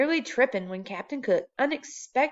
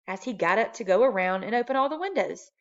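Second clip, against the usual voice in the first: plain reading voice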